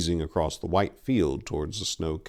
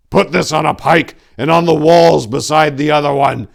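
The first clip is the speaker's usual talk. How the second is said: loud, high shout over a storm; shouting over wind; 7 semitones higher, 10 dB louder than usual. A narrator's reading. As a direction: call out over the howling wind. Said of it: "Tor cried over the howling wind".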